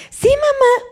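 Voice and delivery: put-on high little child's voice. Child lying to mother about vegetables, high child voice. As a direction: answer like a small kid fibbing.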